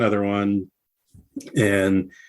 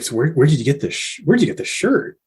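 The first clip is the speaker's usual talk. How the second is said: imitated amazement climbing much higher; fast, higher astonished question; amazed question; 5 semitones higher, faster than usual. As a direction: act out Dean asking eagerly where the shirt came from. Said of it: "he's like".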